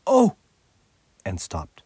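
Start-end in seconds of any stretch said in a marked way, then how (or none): 0.1-0.4 sudden high, loud gasp of "Oh"; a girl's sharp startled exclamation; surprised cry. A narrator's reading. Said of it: "both the girls suddenly cried".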